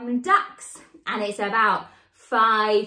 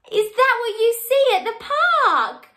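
surprised; 9 semitones higher, 3 dB louder than usual. Acted. Surprised reveal of duck toy.

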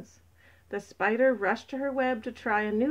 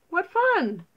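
high, loud exclamation of glee; thrilled; 9 semitones higher, 6 dB louder than usual. A narrator's reading. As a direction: burst out with delight, voice way up. Nata thrilled by the news.

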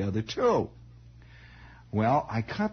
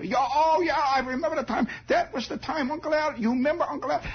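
put-on high-pitched, rambling old German uncle; Uncle Emil; 14 semitones higher than usual. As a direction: reminisce excitedly in a high German-accented voice. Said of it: "he's going on like this".

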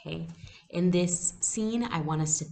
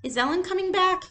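put-on much higher, hopeful child's question; eager little girl; 6 dB louder than usual. A narrator's reading. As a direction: ask like a small child missing her friend. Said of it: "Kirsty asked".

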